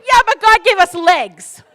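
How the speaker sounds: loud, slow and emphatic; defiant declaration. Real, not acted. Mother answering her son and then the crowd, loud and defiant. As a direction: land it like a sermon.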